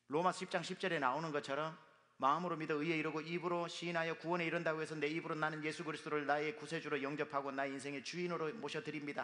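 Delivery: ordinary talk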